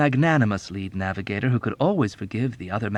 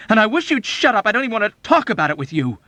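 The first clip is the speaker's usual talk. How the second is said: high, loud and fast; desperate shout; 11 semitones higher, 6 dB louder, faster than usual. Acted. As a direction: blurt it out angrily to shut him up. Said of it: "Nately shouted desperately".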